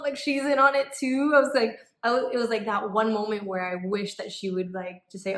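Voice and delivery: usual speaking voice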